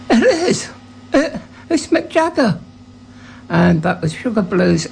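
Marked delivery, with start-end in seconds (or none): 0.1-2.6 much higher, thrilled gasp of disbelief; excited recognition. Retold or imitated. Harmonica player spots [name] in the doorway, thrilled and much higher. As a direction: blurt it out, starstruck.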